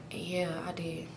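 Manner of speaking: low, very soft short answer; acted under-the-breath reply; retold hushed reply. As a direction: murmur it so nobody else hears. Answering seatmate during the funeral.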